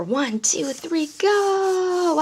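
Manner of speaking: playful countdown to jump; excited countdown. Shown as a demonstration. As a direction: build up to go. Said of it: "verbal routine".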